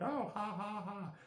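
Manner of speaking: high, mocking ha-ha cackle; mock teasing laugh. Imitating Brittany laughing at his long legs, high mocking cackle. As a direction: laugh at him the way a teasing friend would. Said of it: "she'd be all like".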